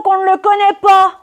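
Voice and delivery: high, sustained put-on voice; mock chorused reply. Woman mimicking the concierges denying they know her cousin, high intoning. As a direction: intone the answer on one high note.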